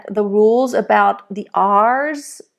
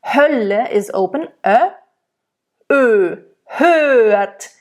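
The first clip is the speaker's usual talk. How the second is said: ordinary talk, nothing marked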